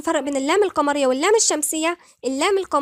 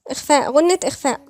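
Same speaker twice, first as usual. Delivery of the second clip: ordinary talk, nothing marked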